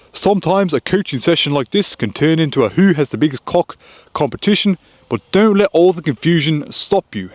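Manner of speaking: put-on New Zealand accent. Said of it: "in his New Zealand accent".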